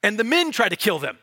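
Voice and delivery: usual speaking voice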